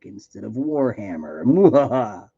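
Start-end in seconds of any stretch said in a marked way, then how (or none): 1.4-2.3 hammy evil cackle; evil villain laugh. Pretend. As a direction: mwahaha like a cartoon villain. Villain laugh after mock threat.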